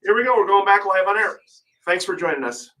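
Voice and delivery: high, projected live-radio welcome; radio show intro. Real, not acted. We're going back live on air.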